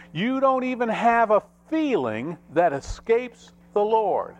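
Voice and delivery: high, emphatic preacher delivery; forceful preaching. Real, not acted. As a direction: preach it high, landing each phrase. Preaches a point, repeated with force.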